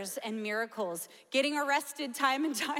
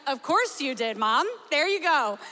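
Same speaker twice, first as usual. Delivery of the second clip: louder, laughing-along resignation; amused exasperation; 8 dB louder than usual. Retold or imitated. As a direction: give it a fond eye-roll, loud. Reacting to mom's roadside news.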